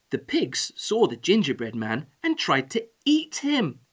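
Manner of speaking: plain reading voice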